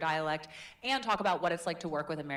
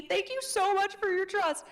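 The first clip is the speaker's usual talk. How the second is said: high voice; 14 semitones higher, 6 dB louder than usual. Acted. Playful high praise to class.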